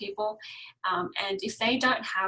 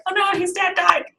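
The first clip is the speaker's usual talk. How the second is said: mock sad cry; 9 semitones higher, 9 dB louder than usual. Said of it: "it's like".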